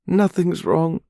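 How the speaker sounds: high, loud and tearful, gasping for air; gulping and gasping. Acted. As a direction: choke it out between sobs. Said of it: "she gulps and gasps".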